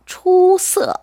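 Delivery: 초 held high and level, 서 falling sharply; first-then-fourth tone. Shown as a demonstration. Chinese teacher showing how to pronounce the word, tone demo. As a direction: model 초서 slowly with high 초, dropping 서. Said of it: "사성으로 발음해 주세요".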